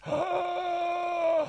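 a high, sharp mock-horror gasp; dramatic gasp. He hams up the news panic over sars with a high gasp. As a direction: gasp as if the news just terrified you.

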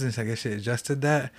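plain reading voice

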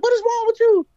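very high, loud mock-wifely outcry; alarmed wife impression. Wife to panicking husband driving, high alarmed outcry. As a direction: screech it as an exasperated wife.

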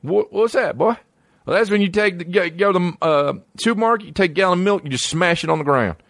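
high put-on dumb Texan bro voice; mock Texan bro. Comedian voicing the gallon-smashing guy, high mock Texan bro voice. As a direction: play a hyped-up Texan goofball. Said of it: "He's like".

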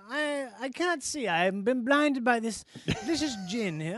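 a hint of a posh British colonial gentleman; posh British accent. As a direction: play a pompous English gent, lightly. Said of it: "British guy".

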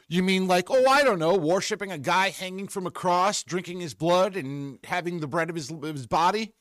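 high, mock-innocent sarcasm; sarcastic. Real, not acted. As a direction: feign innocence while jabbing.

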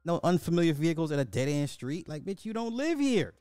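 high, worked-up scolding; heated rant. Real, not acted. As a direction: build the scolding. Reactor ranting at the driver.

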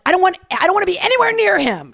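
high, loud refusal; loud protest. Retold or imitated. To the instructor about the panicking kid, loud high protest. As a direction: shout it, wanting nowhere near him.

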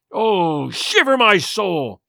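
slow, drawn-out oath of frustration; anguished cry. A narrator's reading. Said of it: "he cried".

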